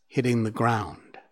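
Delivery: plain reading voice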